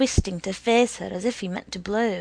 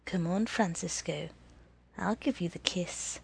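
businesslike; 2 semitones lower, 7 dB quieter than usual. A narrator's reading. Woman making Francisco an offer of a kiss, businesslike. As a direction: keep it quietly businesslike. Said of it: "Her voice was business".

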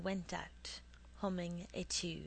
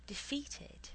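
ordinary delivery, nothing marked

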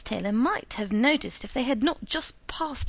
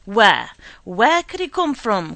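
sharp, loud alarmed cry; loud startled cry of where; cried out; 11 dB louder than usual. A narrator's reading. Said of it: "cried Mister Taylor".

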